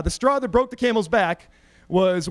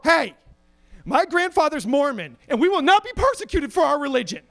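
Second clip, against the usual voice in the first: raised-pitch righteous protest; mock indignation; 5 semitones higher than usual. Student president defends his lie to the VP, mock indignant. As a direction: protest grandly, as if wronged.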